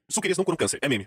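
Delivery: usual speaking voice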